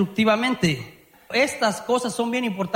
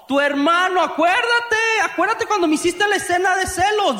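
mock accusation; 8 semitones higher than usual. Spouse dragging up the partner's brother in a mock fight. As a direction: throw the jealousy back at them. Acted quarrel, counter-accusation.

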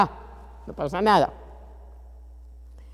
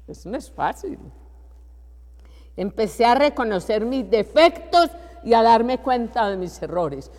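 normal talking voice

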